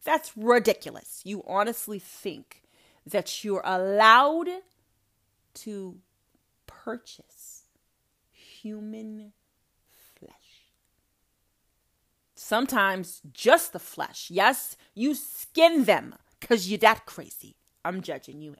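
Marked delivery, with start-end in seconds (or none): 0.0-4.5 mock preacher. Comedy character, preacher persona. 5.5-10.7 hushed, drawn-out horror at each word; dramatic whisper. Acted. Preacher whispering in shock at a flesh buyer. 12.4-17.5 mock preacher. Comedy character, preacher persona.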